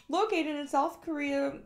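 slow, bright announcer read. Acted. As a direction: present it like a TV ad. Reads the product script.

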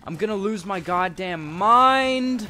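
slow, loud, exasperated; frustrated yelling. Real, not acted. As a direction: drag it out in frustration. Gamer raging at hard level.

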